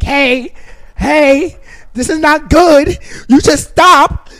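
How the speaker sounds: high, loud alarmed shout; remorse calling out. Acted. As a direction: yell a warning as nagging remorse. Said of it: "remorse starts knocking on my door like".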